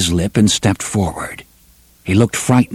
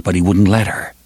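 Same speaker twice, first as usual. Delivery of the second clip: plain reading voice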